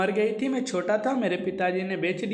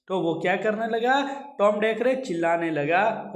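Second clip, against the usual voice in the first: normal talking voice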